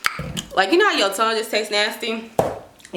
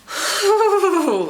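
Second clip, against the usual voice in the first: sigh; 12 semitones higher, 3 dB louder than usual. Real, not acted. Contented sigh over snack.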